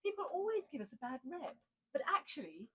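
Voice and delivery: high, hammed-up posh English voice; mock-posh reply, high and soft; ridiculous posh accent. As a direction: overdo the plummy accent. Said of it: "I was being ridiculous".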